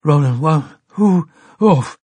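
dazed, surprised, rising to a grunt; confused mumble ending in an oof; dazed surprise. A narrator's reading. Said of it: "a grunt of surprise".

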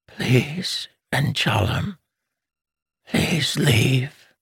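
weak pleading mother. Acted. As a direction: beg him gently with failing breath.

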